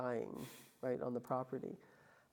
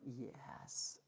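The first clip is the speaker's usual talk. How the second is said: whispered, much softer; whispered yes; 3 semitones lower, 10 dB quieter than usual. Retold or imitated. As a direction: breathe the yes out, voiceless. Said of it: "I was like".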